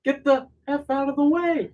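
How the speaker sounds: high, hammed-up yell; cartoonish high shout while free falling; mock yell. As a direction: yell it like a falling hero losing it. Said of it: "he goes".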